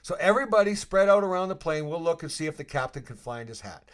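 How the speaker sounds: rally the plane jokingly; mock announcement. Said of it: "I'm like".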